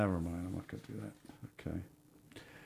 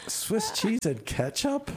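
high, slow, incredulous question; can't believe what he just heard; disbelief; 11 semitones higher, slower than usual. Real, not acted. Host reacting to cheese with ketchup, incredulous.

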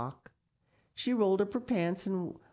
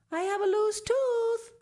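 put-on high, loud little girl's voice; little girl calling; 6 dB louder than usual. A narrator's reading. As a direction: call out brightly like a small child. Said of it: "Sal called to the loon".

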